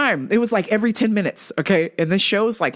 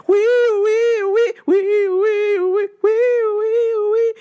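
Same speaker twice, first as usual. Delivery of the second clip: high loud siren wee wee; imitated police siren; 14 semitones higher, 4 dB louder, much slower than usual. Comic man imitating a cop car siren, police siren. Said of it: "like a cop car".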